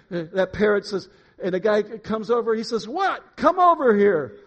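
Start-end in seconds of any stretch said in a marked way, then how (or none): 2.9-4.4 high, loud comic holler; shouted summons. Acted. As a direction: call across the store, pitched right up. Acted shouting between man and parrot.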